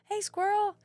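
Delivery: high, bright call across the hallway. Retold or imitated. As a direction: call out to her like a kid. Kids calling out to her.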